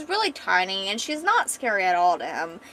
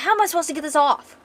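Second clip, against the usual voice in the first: fast, higher exasperated complaint; really aggravated; 7 semitones higher, 4 dB louder, faster than usual. Acted. Woman unable to get bandages off, aggravated. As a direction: rush it out in acted frustration. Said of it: "she's starting to get really aggravated".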